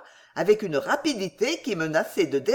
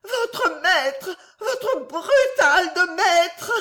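very high, tearful wail, drawn out; stammering invalid; 11 semitones higher, 4 dB louder, much slower than usual. A narrator's reading. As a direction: sob it out like a hysterical wife. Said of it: "balbutia la malade". (plainte larmoyante et suraiguë de malade)

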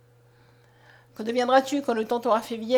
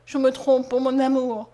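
sobbing reproach; 2 semitones higher, 8 dB louder than usual. A narrator's reading. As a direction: lament loudly, wounded to the heart. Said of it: "répondit en sanglotant Mariette".

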